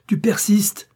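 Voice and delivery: high, loud, incredulous fury; enraged madman. A narrator's reading. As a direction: throw it out as a furious challenge. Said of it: "continua le forcené".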